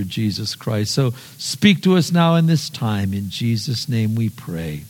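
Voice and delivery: low, hushed pastor's prayer. Acted. Opening prayer before sermon.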